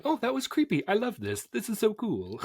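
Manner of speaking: very high, gushing fan reaction; delighted squeal. Retold or imitated. Fan recalls reacting to a creepy entrance, high delighted squeal.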